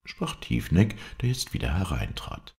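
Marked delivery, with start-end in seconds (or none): none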